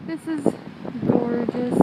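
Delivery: muffled through a face buff. Real, not acted. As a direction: speak through cloth over mouth. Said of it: "Sorry if my voice is muffled".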